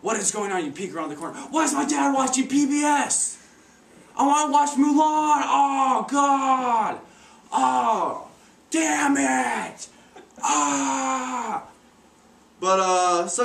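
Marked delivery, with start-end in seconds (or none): 1.5-11.6 put-on high, loud whiny kid shouting; a kid throwing a loud, whiny fit; childish whine. Kid whining about the TV show.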